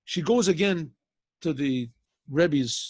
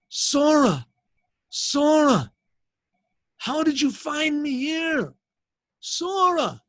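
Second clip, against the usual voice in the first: high, loud and drawn-out calling of her name; surprised calling out; 9 semitones higher, 5 dB louder, much slower than usual. Acted. Long-lost husband calls to his wife across the bar, astonished.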